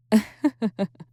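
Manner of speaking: short, high, delighted demon laugh; amused laugh. Acted. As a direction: laugh at her protest. Amused at the hunter's refusal.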